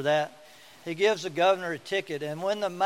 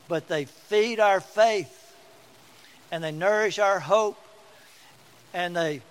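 preaching fervently; 3 semitones higher, 4 dB louder than usual. Acted. Sermon climax.

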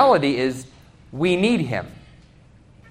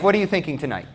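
normal talking voice

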